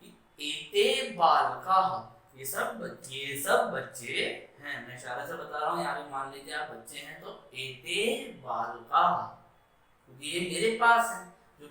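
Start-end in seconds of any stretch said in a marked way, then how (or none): 0.0-1.8 एते बालका said slowly, rising on एते; slow Sanskrit demo. Shown as a demonstration. Teacher modelling the plural for the children. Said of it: "तो क्या बोलूंगा". 7.2-9.0 एते बालका said slowly, rising on एते; slow Sanskrit demo. Shown as a demonstration. Teacher modelling the plural for the children. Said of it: "तो क्या बोलूंगा".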